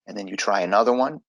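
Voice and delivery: ordinary talk, nothing marked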